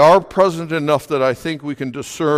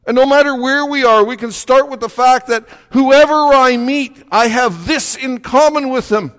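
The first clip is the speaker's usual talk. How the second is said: higher-pitched, driving pulpit delivery; impassioned preaching; 8 semitones higher, 4 dB louder than usual. Real, not acted. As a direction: preach it with rising conviction.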